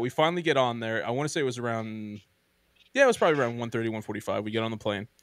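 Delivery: ordinary talk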